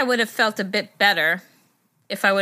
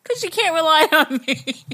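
giggling, words breaking up; laughing hard mid-sentence; hiccup giggles; 9 semitones higher than usual. Real, not acted. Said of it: "[name] can't stop laughing".

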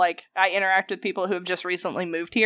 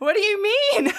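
mock bafflement; 13 semitones higher than usual. Worker puzzled by customers' praise, mock bafflement. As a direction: act baffled, voice pitched way up.

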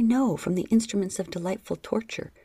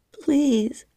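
high, louder pleading cry; high loud please; cried out; 7 semitones higher, 6 dB louder than usual. A narrator's reading. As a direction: cry out the plea. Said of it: "I cried".

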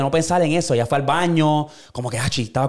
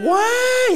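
put-on baby wail. Imitating the crying baby in the retold episode, high mock wail.

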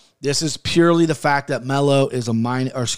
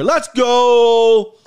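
loud, high rallying yell; big hype yell to start the show; hyped-up shout; 9 semitones higher, 7 dB louder than usual. Real, not acted. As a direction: kick it off with a drawn-out holler. Hyping first picks of the year.

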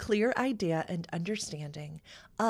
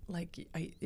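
soft whispered aside; 12 dB quieter, slower than usual. Real, not acted. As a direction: breathe it out quietly. Whispering about stressful start.

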